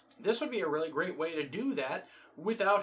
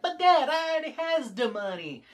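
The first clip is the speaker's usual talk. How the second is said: daughter voice; 7 semitones higher, 4 dB louder than usual. Retold or imitated. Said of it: "she's all like".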